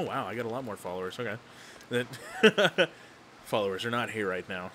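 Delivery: normal talking voice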